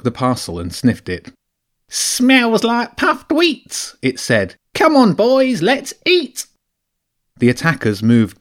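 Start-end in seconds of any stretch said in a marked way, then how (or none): 1.9-4.0 very high, slow squeaky rat voice; a small rat, sniffing greedily. Acted. Hungry rat sniffing the food parcel, squeaky high voice. 4.7-6.5 very high, slow squeaky rat voice; a small rat, sniffing greedily. Acted. Hungry rat sniffing the food parcel, squeaky high voice. Rat calls his gang to eat.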